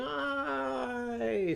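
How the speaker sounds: a high "Aye!" as if soaring; high aye cry. Acted. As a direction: call out "Aye!" while flying up. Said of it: "riding up into the sky and going".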